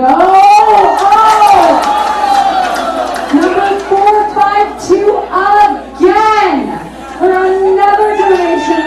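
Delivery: high, loud, slow announcement; excited shout. Real, not acted. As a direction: shout it out in amazed delight. Surprised by repeat bidder.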